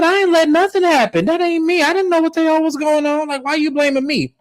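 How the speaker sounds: very high defensive mock voice; imitating Cliff. Cliff denying blame to Glenn, very high mock voice. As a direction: squeal the denial defensively. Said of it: "And Cliff said".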